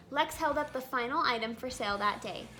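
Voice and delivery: ordinary delivery, nothing marked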